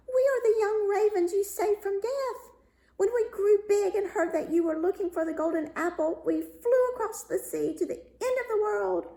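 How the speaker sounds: high, strange bird voice, eager and grateful; little birds chattering their good news, pitched high; young ravens. A narrator's reading. Young ravens speak to the youth.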